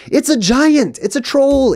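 high-pitched, emphatic mock answer; high and insistent, spelling out the obvious; exasperated exclamation. Mock answer to the blind woman.

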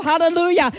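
ordinary talk

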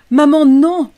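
high, very loud scream of protest; child's scream. A narrator's reading. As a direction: scream out to stop a parent. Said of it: "hurla Rachel".

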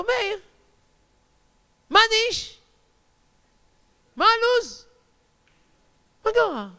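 high-pitched calls across a distance; high, spaced-out hollers; calling out. Retold or imitated. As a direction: call out casual greetings way up high. Rabbi calls out to distant man.

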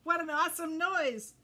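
loud, excited exclamation; amazed delight. Acted. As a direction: burst out with wonder. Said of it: "said Footloose".

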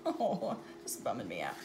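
usual speaking voice

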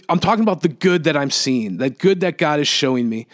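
ordinary talk, nothing marked

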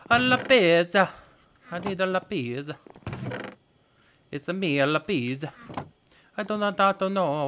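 mock Italian accent. Gamer lapsing into mock Italian accent for viewers. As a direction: play a cartoon Italian plumber. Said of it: "I'm talking like a this".

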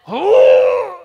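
high loud squeal of disgust; sudden loud comic squeal; mock squeal.

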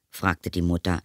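ordinary delivery, nothing marked

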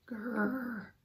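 drawn-out "grrr"; jaguar growl. A narrator's reading. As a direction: growl like a big cat.

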